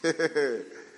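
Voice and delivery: short real laugh at his own joke; amused laugh. Real, not acted. Preacher laughing at his own story to the congregation. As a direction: laugh out at the punchline.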